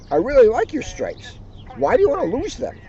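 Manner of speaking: Kanga voice. Acted. Kanga asks Tigger why he wants to lose his stripes, gently. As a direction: ask kindly, speeding up on the question. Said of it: "Kanga said".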